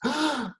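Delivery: a sharp, high mock gasp of shock; imitated gasp. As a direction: act out his gasp, quick and airy. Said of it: "and he gasps".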